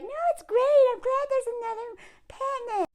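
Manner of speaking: high, falsely bright voice; high mock-sweet wife; forced cheerful wife. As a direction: sound fine through clenched politeness. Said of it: "She has to be like".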